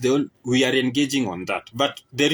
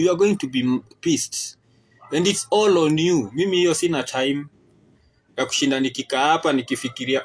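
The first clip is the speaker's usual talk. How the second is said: ordinary talk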